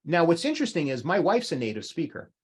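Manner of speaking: ordinary talk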